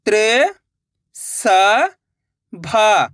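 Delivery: त्र, सा, भा drawn out with gaps; syllable by syllable. Shown as a demonstration. Teacher breaking a word for learners, syllable by syllable. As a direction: sound out त्र, सा, भा singly. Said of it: "एक अक्षर का उच्चारण करके".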